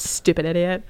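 soft, low mock insult; hushed imitated jab; imitated scolding. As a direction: mutter it as a mock reproach. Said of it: "looking at me, like, hard".

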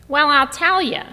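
a mother launching into advice; lightly hammed-up chatty grandmother; grandma on phone. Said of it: "she started".

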